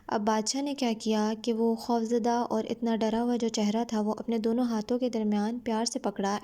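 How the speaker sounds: ordinary talk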